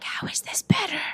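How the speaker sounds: breathy disbelieving whisper; hushed mock disbelief; incredulous whisper. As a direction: whisper it, baffled. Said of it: "and I'm like".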